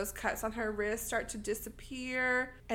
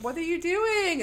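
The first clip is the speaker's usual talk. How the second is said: high, loud imitated demand; mock indignant yell; 8 semitones higher, 5 dB louder than usual. Host plays Nancy as her friends flee, mock indignant yell. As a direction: call after deserters in mock outrage.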